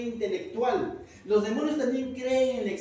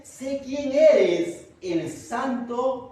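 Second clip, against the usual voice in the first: very slow, loud, higher demon cry; 4 semitones higher, 7 dB louder, much slower than usual. Acted. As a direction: stretch each word out loud like a terrified spirit. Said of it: "¿Y qué dice?".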